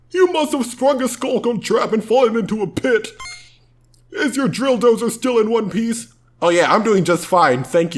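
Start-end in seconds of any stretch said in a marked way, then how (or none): 0.1-6.1 high sidekick voice. Acted.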